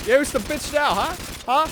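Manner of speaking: loud, very high-pitched crowing; mock-triumphant taunt. Real, not acted. Hitting back at the co-host's insult, high, loud taunt.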